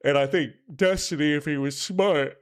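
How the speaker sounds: talking through a yawn; words stretched by a yawn. Real, not acted. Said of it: "sorry for yawning in your earballs".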